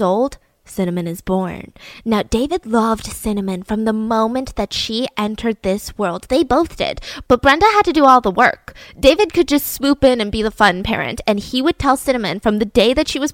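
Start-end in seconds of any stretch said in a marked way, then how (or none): none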